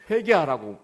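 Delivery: loud falling pulpit cry; shouting repent. Retold or imitated. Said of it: "큰 소리로 외치기 시작했어요".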